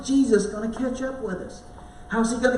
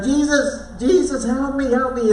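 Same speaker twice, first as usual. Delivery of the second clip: loud, higher cry for help, sinking; screaming for help; 5 semitones higher, 5 dB louder than usual. Retold or imitated. Peter sinking in the waves, crying out to Jesus. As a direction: shout for rescue as the waves pull you under. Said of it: "I screamed out".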